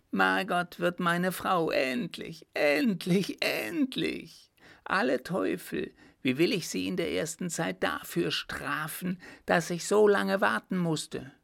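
high, eager gloating voice; gleeful gloating. A narrator's reading. Baron exults to the captain about Margot, gleeful. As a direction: savour every endlich greedily.

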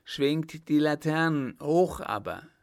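loud rallying command; called-out order. A narrator's reading. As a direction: call the order out to a crowd. Mayor shouts orders to crowd.

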